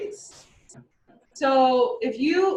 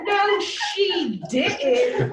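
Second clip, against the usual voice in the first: higher, mock-alarmed inner voice with a laugh; startled primal brain; 9 semitones higher, slower than usual. Lecturer voicing a primate brain reacting to a yawn, high and mock startled. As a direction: play the jumpy lizard brain, half laughing. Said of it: "your primal lizard primate brain is like".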